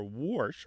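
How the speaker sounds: "warsh" said as "worsh", falling; R insertion. Shown as a demonstration. Host naming the mapped word, intrusive-R pronunciation. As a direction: say "warsh" with an R before the sh. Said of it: "or this R insertion".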